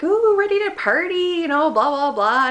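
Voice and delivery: high, loud, hammed-up whoop easing down; party-ready excitement. Acts out her excited arrival.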